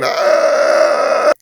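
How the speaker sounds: long, loud hammed-up scream; drawn-out comic shriek; mock scream. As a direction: scream in exaggerated horror. Acted scream at the demon.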